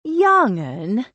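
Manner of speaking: "Young 'un" thrown back, rising in pretend outrage; mock indignation. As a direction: toss "Young 'un" back with playful offence. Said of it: "Lily said in mock indignation".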